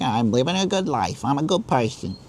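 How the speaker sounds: a light, mocking New York accent; mock New Yorker accent. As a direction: play a self-satisfied New Yorker in a lightly done accent. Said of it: "is always a New Yorker".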